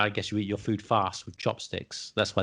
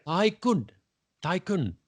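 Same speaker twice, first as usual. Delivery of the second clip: Tycoon said high with a mock Japanese accent, stressed and falling; playful fake Japanese accent for the learner; 6 semitones higher, slower than usual. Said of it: "shall I say in my best accent?".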